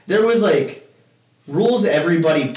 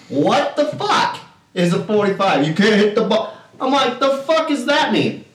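retold pissed off; 7 semitones higher than usual. Player challenges opponents over the ball, pissed off. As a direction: draw out the indignant question. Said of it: "Y'all starting to piss me off".

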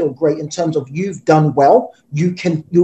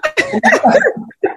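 high-pitched burst of laughter. Real, not acted. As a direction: laugh out at your own story. Laughs after mum impression.

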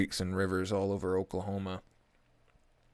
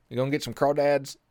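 loud, high-pitched country caricature; 7 semitones higher, 7 dB louder than usual. Retold or imitated. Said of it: "the whitest, most country motherfucker".

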